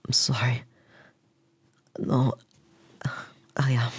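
whispered, teary sorry; tearful apology. Acted. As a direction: apologise while still crying. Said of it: "I'm so sorry for starting crying".

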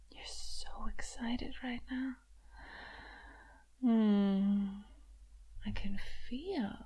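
soft unvoiced whisper. Mistress whispering to her toy, teasing. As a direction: breathe it close to the ear.

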